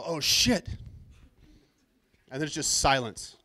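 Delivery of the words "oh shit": sudden louder, higher curse over the intercom; startled oh shit. Retold or imitated. Pilot cursing into the intercom, startled and louder. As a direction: blurt it out like a caught-off-guard pilot. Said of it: "you heard him go".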